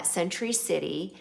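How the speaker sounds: usual speaking voice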